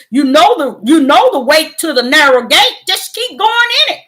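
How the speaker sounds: loud, high, rousing exhortation; urging the faithful, shouting to stand firm; full-force preaching. Acted. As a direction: preach it hard to the flock. Preaching to her viewers.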